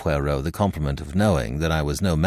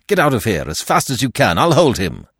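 loud, raised, urgent command; forceful, hurried-sounding warning; urgent order; 4 semitones higher, 5 dB louder than usual. A narrator's reading. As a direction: send her running.

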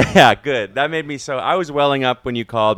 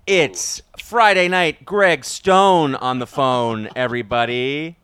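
mock announcer intro; 2 semitones higher, 6 dB louder, slower than usual. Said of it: "announcing the guest".

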